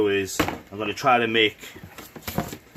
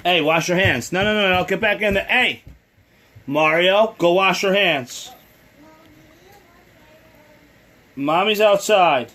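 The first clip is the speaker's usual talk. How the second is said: calling from far away; 9 semitones higher, 6 dB louder than usual. Real, not acted. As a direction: call across the house. Parent yelling to child elsewhere.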